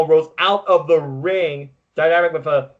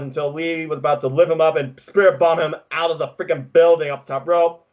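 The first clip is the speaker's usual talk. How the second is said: ordinary talk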